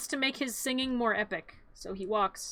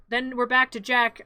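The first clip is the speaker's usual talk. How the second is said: ordinary delivery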